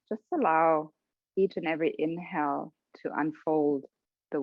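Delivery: calm, even voice with long pauses; guided meditation. Real, not acted. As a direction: settle them gently into their breathing, unhurried. Leads a breathing meditation.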